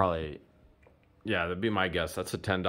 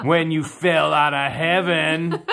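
loud, higher, drawn-out pickup line; over-the-top cheesy wooing; hammed-up flirt; 5 semitones higher, 5 dB louder, slower than usual. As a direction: gush it with mock adoration. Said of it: "This potion turned you into another person".

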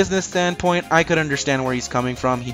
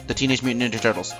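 small print voice; 3 semitones lower, 6 dB quieter, much faster than usual. Shown as a demonstration. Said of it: "in small little letters".

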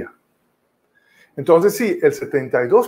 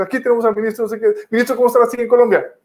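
high, fast host voice, hammed up; hurried TV anchor; 7 semitones higher, much faster than usual. Journalist rushing the third take on air, hurried high anchor voice. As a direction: race through it like an anchor out of time. Journalist restarting the interview again.

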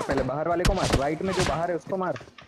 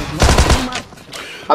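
loud urgent shout; 8 semitones higher, 10 dB louder, much slower than usual. Real, not acted. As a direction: yell to shoot now. Urgent call to shoot.

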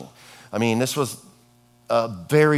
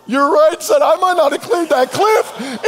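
laughing along; 6 dB louder than usual. Retold or imitated. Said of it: "I'm like".

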